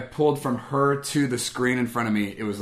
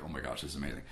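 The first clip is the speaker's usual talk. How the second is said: low, hushed amazement; softly awestruck, retold; quiet awe; 7 semitones lower, 14 dB quieter, faster than usual. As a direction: murmur it low, overwhelmed. Retold awe while crying on plane.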